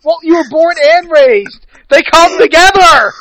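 high-pitched, loud, laughing through words. Real, not acted. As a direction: crack up while talking. Cracking up at the argument.

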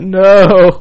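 loud, high hammed-up cry of despair; mock anguished no. The rower told the sushi has run out, loud mock despair. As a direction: wail it like the sushi is gone. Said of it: "He's like".

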